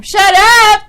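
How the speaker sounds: loud, high-pitched shout; yelled command. Acted. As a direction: yell it at unruly dogs. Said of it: "yell at 'em".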